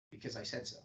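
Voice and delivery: low, soft and final, falling on said; Because I said so, dropping low with no room for reply; downward inflection. Shown as a demonstration. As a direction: close it off like a parent ending the argument. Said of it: "the parent telling the child".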